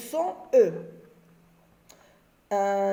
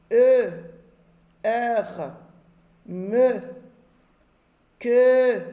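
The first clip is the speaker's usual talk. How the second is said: loud, clearly separated syllables with pauses; syllable drill; 2 semitones higher, 4 dB louder, much slower than usual. Shown as a demonstration. Teacher to pupils, drilling the syllable chart loudly. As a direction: bark out each syllable from the chart. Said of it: "On reprend les syllabes".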